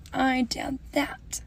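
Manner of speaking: unvoiced slow whisper; hushed confession. Real, not acted. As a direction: whisper it like a guilty secret. Confessing a secret prank.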